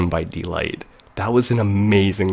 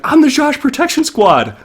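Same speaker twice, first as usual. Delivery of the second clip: much higher, louder mock-heroic proclamation; heroic announcement; 5 dB louder than usual. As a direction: announce yourself like a cartoon rescuer, pitched way up. Friend bursting into the apartment.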